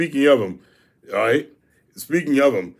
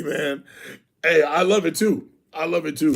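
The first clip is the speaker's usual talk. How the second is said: ordinary talk, nothing marked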